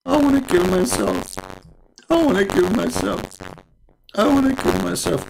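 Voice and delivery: mock despair. Muhammad voiced despairing on the climb.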